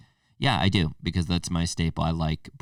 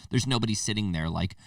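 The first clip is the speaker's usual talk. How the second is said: ordinary talk, nothing marked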